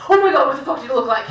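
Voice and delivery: fast retold burst of shock; sudden emotion. Imitating stoic Faye finally cracking over the nose, shocked. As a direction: drop the stoicism and blurt it out quickly. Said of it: "she shows emotion in her face".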